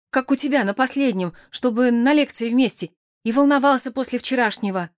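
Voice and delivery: put-on distraught young man pouring out his story; tearful, muddled rambling. A narrator's reading. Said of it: "Заговорил сбивчиво, непонятно".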